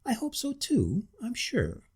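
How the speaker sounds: lightly voiced muddled waiter. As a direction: answer both at once, confused. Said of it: "in his muddle-headed way".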